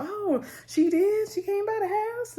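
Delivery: drawn-out, high-pitched imitation of a mother's disbelief; mock surprise. Retold mother's reaction to news.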